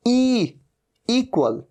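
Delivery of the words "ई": normal talking voice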